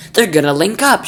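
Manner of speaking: shouting. Acted. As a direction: blurt it out at the screen. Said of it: "shouted the president".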